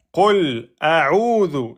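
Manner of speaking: slow chanted "a'udhu" with a drawn-out u; long vowel. Shown as a demonstration. Teacher pointing out the prolongation, "a'udhu" held long. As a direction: stretch the middle of "a'udhu". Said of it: "Ici, une prolongation".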